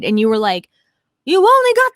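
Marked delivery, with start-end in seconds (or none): 1.3-1.9 high, loud mock outrage; acted angry protest, pitched way up; imitated mad friend. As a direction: retell a friend's indignant disbelief. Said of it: "you were mad".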